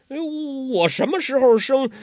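a high, loud startled protest; puzzled protest. Acted. Guo Jing protesting the girl's claim, startled and loud. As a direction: blurt it out, caught off guard.

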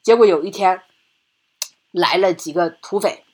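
normal talking voice

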